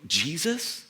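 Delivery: usual speaking voice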